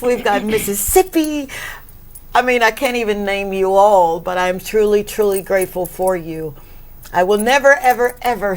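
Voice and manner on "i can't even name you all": ordinary delivery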